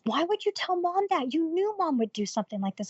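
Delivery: high-pitched imitation of a scolding sister; incredulous reproach, voiced up high; retold reproach. Sister questions her telling Mom, imitated reproach.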